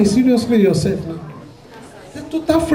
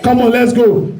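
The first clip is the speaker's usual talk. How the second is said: usual speaking voice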